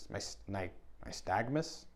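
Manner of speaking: quiet, puzzled question; unsure. Real, not acted. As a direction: try the word uncertainly, asking "nystagmus?". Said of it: "I don't even know what that is".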